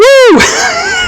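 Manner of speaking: squealing laugh that breaks upward; a high, delighted laugh; giggling burst; high burst of laughter. Real, not acted. Laughs at his own retelling.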